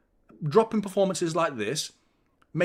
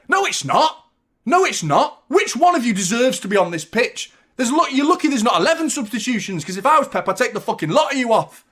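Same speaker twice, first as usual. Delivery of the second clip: loud, higher furious yelling; furious rant; 7 semitones higher, 7 dB louder than usual. Real, not acted. Fan screaming at his own players, furious. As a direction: blast the team with rage.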